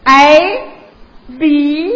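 put-on letters I and B in a Dongbei accent; Northeast-accented English. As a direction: recite letters with Northeast tones. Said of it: "请同学们注意我这个发音".

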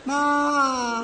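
soft bleating call to mum; goat voice. Pretend. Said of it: "谁让你学羊叫唤了".

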